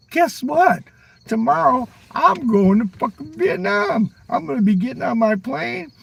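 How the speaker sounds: high, giddy mock excitement; excited. Traveler telling himself about tomorrow's flight, giddy and high. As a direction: squeal it out like a kid before a trip. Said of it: "you go".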